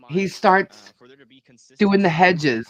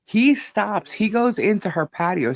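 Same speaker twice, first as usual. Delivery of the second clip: normal talking voice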